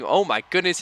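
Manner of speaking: sudden high excited outburst; excited exclamation. Real, not acted. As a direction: commentator thrilled by the lap time. Driver surprises with P2 time.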